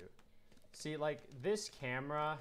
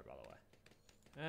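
whisper; 11 semitones lower, 13 dB quieter, much slower than usual. Real, not acted. Whispered aside.

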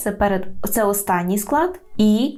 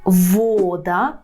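вода with a long, stressed во; first-syllable stress. Shown as a demonstration. Teacher models Polish for learners, stress on во in вода. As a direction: lean on во in вода. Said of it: "так само".

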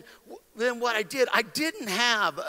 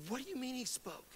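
very soft, stunned question; hushed disbelief; 18 dB quieter than usual. Retold or imitated. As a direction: ask it barely aloud, in disbelief. Stunned hushed retold exchange.

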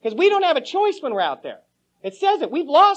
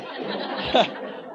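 unvoiced breathy chuckle; breathy laugh; 5 dB quieter than usual. Real, not acted. Laughing about getting fired, breathy chuckle. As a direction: let a silent laugh slip out.

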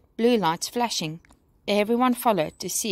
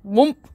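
loud popping whoomp sound; 2 semitones higher, 8 dB louder than usual. A narrator's reading. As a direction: burst it out. Dragon pops free.

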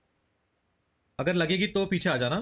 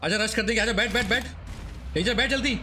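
urgent rally; 6 semitones higher than usual. Real, not acted. Rallying teammates to rush the last enemy, urgent. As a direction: hurry them into the car.

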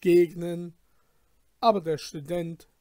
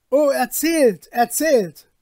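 louder, higher, eager plea; "erzählt" repeated, both loud and eager; eager begging; 9 semitones higher, 13 dB louder than usual. Acted. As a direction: beg eagerly to hear the tale. Guests eagerly begging for story.

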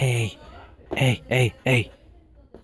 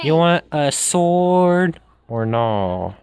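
slow, drawn-out offer; impatient repeat; much slower than usual. Real, not acted. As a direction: stretch out each word for someone not listening.

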